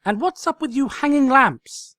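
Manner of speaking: loud, high frantic cry; loud shrieking demand; cried out. Acted. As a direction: yell it like a crazed drill sergeant. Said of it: "she cried".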